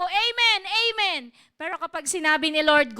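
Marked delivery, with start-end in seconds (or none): 0.0-1.2 loud, high congregation amens; imitated enthusiastic church response; mock eager amens. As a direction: call out amen like an eager believer. Mimics congregation cheering prosperity promises.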